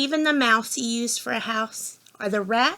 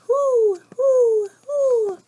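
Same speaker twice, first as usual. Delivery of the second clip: high, loud, falling hoots; owl hooting; 11 semitones higher, 5 dB louder, much slower than usual. Pretend. Reader showing what an owl says, high imitated hooting. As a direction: hoot three times like an owl. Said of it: "It says".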